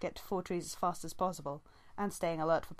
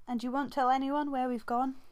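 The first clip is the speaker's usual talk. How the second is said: pressing; 7 semitones higher, 5 dB louder than usual. A narrator's reading. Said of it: "Squirrelpaw pressed".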